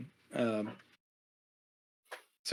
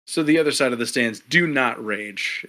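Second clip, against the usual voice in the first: ordinary talk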